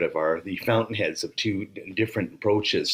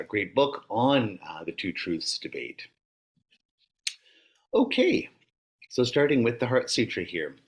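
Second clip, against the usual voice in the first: ordinary talk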